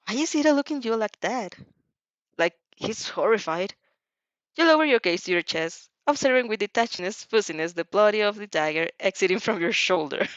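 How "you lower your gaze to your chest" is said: usual speaking voice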